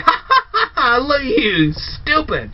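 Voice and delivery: gloating high cackle, then a sneer; mocking villain laugh. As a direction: laugh down at him like a smug villain. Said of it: "Khan should have been laughing, going".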